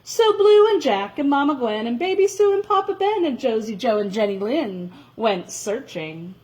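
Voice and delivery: ordinary delivery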